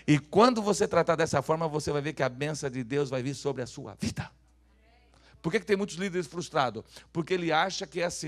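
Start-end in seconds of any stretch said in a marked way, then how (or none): none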